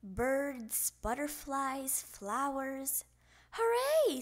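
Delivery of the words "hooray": high, loud hooray; little frog's gleeful cheer; excited singsong cheer. A narrator's reading. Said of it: "sang Froggy".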